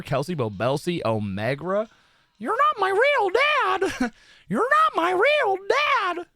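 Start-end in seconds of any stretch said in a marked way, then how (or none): 2.4-4.1 much higher, slow squeaky whine; bratty kid voice. Acted. Streamer jokes to chat as a bratty kid, squeaky and slow. 4.5-6.3 much higher, slow squeaky whine; bratty kid voice. Acted. Streamer jokes to chat as a bratty kid, squeaky and slow. Repeats it, laughing first.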